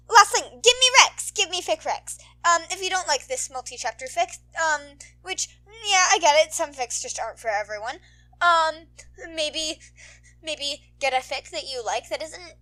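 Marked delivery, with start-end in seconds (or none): none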